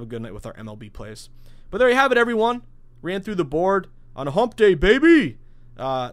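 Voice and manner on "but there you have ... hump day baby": big loud celebratory holler, pitch way up; excited shout. Real, not acted. Podcast host celebrating a winning night to listeners, excited shout. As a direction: crow about the sweep like a sports fan.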